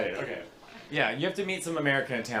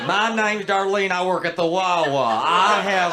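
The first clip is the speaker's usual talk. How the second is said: mock American lady voice, slow and louder; hammed-up Darlene from the Wawa; a proud tourist lady, drawn out; 4 dB louder, slower than usual.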